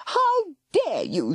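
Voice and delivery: high, slow outraged shriek. A narrator's reading. Sister shrieking at her brother, outraged. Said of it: "she shrieked".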